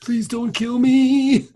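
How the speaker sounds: high, slow, mock-begging voice; mock pleading. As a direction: beg for your life, hammed up. Pleading victim roleplay.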